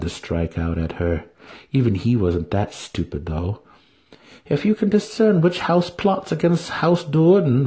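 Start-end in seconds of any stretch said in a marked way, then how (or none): 4.5-7.7 commanding priestess. A narrator's reading. Priestess instructing the wizard, high and commanding. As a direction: give the order from above. Vienna voiced.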